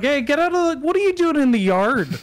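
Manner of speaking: high, loud shouting at a bear; scaring off a bear. Retold or imitated. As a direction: shoo the bear out of the yard. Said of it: "It's like".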